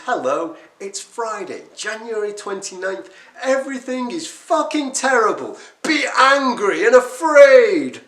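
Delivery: hammed-up alarmist news host building to a yell; doom-mongering newsreader. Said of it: "it says".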